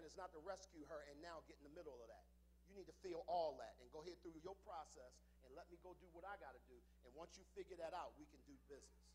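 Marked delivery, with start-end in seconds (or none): none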